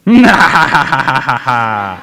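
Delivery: low gloating villain chuckle; evil chuckle. Acted. Grumpy tiger gloating over crying kids, evil chuckle. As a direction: chuckle low and pleased with your own meanness.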